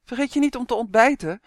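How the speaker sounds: mother shouting a reminder over the phone; calling. A narrator's reading. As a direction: call out the reminder. Mother calls on bad line.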